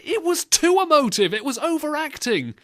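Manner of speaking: high, exasperated protest; indignant rebuttal. Real, not acted. Arguing back at the co-host.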